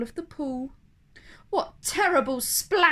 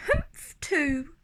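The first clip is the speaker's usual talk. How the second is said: much higher, tiny copycat humph; little kangaroo echo; 5 semitones higher than usual. Acted. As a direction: squeak it back like a baby joey. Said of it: "the young kangaroo in her pouch said".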